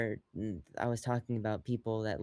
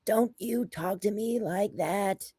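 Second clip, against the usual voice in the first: high, drawn-out scolding; slow, high-pitched impression of a nagging mom; mock scolding mother; 8 semitones higher, much slower than usual. Imitating Tony Soprano's mom in heaven, mock scolding. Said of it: "and she's like".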